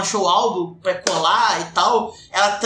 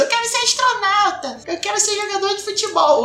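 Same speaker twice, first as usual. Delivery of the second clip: put-on child's voice; 12 semitones higher than usual. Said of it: "aquelas coisas".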